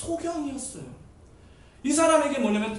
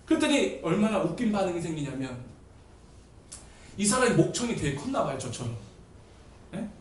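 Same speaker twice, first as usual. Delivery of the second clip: ordinary talk, nothing marked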